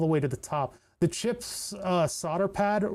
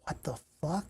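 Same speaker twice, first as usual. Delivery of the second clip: muttered disbelief; 9 dB quieter than usual. Real, not acted. Baffled by board markings.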